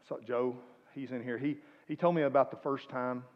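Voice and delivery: ordinary talk, nothing marked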